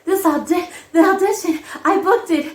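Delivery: high, loud, tumbling excitement; stammer, then burst out with the news; giddy excitement. Retold or imitated. Daughter calling mother with big news, re-enacted giddy excitement. Tells mother she booked it.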